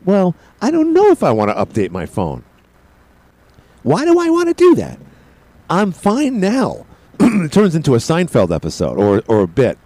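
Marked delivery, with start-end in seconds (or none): 0.1-6.8 high-pitched comic protest; mock indignant reluctance. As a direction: balk at it like a sitcom bit. Acting out resistance to phone update.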